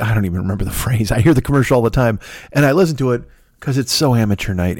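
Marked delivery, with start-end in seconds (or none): none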